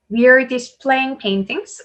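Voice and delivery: slow model reading, lifting up on paintings; rising list intonation. Shown as a demonstration. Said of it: "올라가고요". (paintings 끝을 올려 천천히 읽기)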